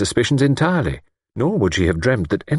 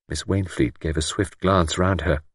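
ordinary delivery

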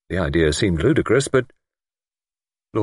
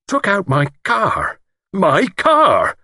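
high, drawn-out indignation building louder; outraged; 12 semitones higher, 4 dB louder, much slower than usual. A narrator's reading. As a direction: exclaim in possessive outrage. Lord outraged at borrowed car.

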